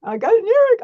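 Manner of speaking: high-pitched whiny imitated sobbing voice; student calling her teacher late at night, whining in a high tearful voice; mock crying teen. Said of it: "at night crying".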